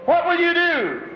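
a preacher voicing an angel's cry. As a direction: cry out the question as an anxious angel. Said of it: "cried the angel".

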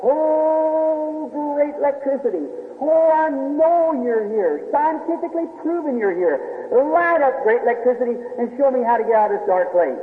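high-pitched pleading cry, hammed-up; mock scream to electricity. Preacher acting out a man crying to electricity. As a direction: call out to the air as if it could hear. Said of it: "And I'd scream to the top of my voice".

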